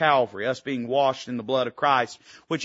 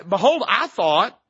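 slow, higher, louder mock Naaman; voicing Naaman; 5 semitones higher, 6 dB louder, slower than usual. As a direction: drag out a proud man's complaint. Preacher voices Naaman's complaint.

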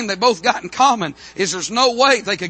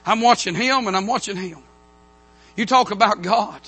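ordinary talk

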